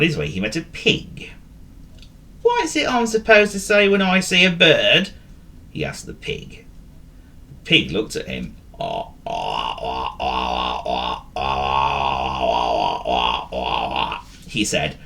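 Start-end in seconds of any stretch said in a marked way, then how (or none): 2.4-5.1 high, loud dog character voice; high-pitched questioning cartoon dog; forgetful dog. Forgetful dog asks the pig. 8.8-14.3 low, slow pig grunts; deep animal noises, drawn out; oinking pig. Acted.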